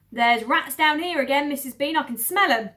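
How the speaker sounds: loud farm woman voice; Mabel calling up. Acted. Farm woman calls up about rats, loud. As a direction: call it up the stairs.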